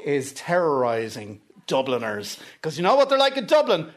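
usual speaking voice

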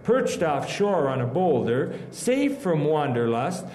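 poetry recitation. Acted. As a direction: recite the poem with feeling. Recites his poem.

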